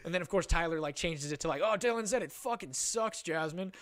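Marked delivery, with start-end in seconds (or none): 1.6-3.7 higher, drawn-out stirring; mock tattling. Friend exaggerating to the girlfriend, drawn-out mock tattle. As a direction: imitate a friend gleefully telling on someone.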